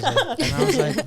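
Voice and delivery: high, loud laughing restart. Real, not acted. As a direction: laugh while trying to get the words out. Laughs recalling the kid.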